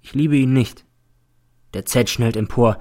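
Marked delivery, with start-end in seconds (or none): none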